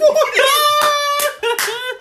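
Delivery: high laughing fit. Real, not acted. Big laugh at impression.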